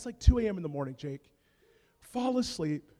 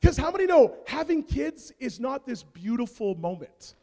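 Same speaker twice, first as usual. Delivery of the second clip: ordinary talk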